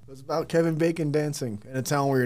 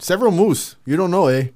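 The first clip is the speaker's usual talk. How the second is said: mock Canadian; 4 semitones higher, 8 dB louder than usual. Joke Canadian with eh.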